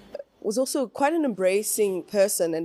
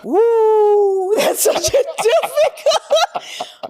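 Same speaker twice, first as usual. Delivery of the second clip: high loud slow laugh; 9 dB louder, much slower than usual. Real, not acted. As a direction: laugh it off in disbelief. Laughing at hard question.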